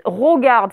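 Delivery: "regarde" said as "rogarde"; imitated mispronunciation. Teacher quotes pupils' mistake, mispronounced "rogarde". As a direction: mimic the error, an o in the first syllable of "regarde". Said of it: "il y en a qui écrivent ou qui disent".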